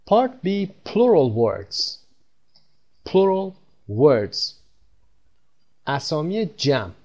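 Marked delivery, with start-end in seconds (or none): 0.1-4.5 Part B, plural words read very slowly and clearly; slow announcing. Shown as a demonstration. Teacher announcing a new section to learners, slow and clear. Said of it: "lesson section heading read out".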